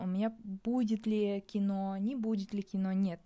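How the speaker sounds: ordinary talk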